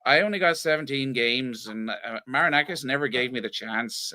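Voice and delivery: low Northern Irish accent impression; Martin O'Neill accent. Shown as a demonstration. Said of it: "judge you on the accent".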